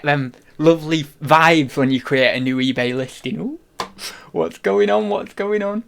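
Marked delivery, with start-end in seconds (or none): none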